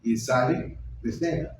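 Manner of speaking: usual speaking voice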